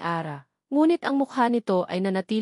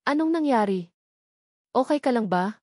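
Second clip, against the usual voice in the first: put-on anxious, higher questions to her child; mildly alarmed mother; worried mother; 3 semitones higher than usual. A narrator's reading. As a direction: rush in full of worry. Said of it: "ang boses ay puno ng pag-aalala".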